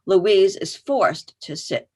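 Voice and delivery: normal talking voice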